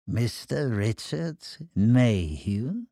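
langsam gedehnter, öliger Anrufer; ölig, fies. Acted. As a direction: dehn den Namen ölig und fuchshaft. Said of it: "Der Tonfall war ölig, fies, fuchshaft". (öliger, fieser Tonfall)

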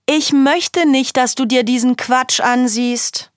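a scolding mother, put on; mum telling her off. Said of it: "Mama jedes Mal reinkommt und sagt".

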